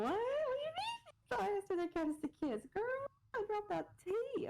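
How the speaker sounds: high, slow incredulous squeal; shocked disbelief. Real, not acted. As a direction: gasp it out as if you can't believe it. Reacting in shock to the post.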